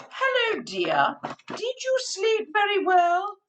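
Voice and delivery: high, sing-song wife voice; cheery Missus Bear. Acted. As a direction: sweetly wake up tired husband. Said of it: "Missus Bear wakes him up and says".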